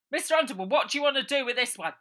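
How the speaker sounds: high, loud, fast lackey; high, loud servant voice; eager henchman. Acted. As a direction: rush the question at the boss. Henchman asks boss.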